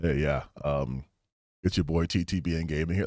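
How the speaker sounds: very low, soft, laid-back intro; off-camera real voice. Acted. Streamer met on the street introduces himself, low casual voice. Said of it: "you hear me on the streets".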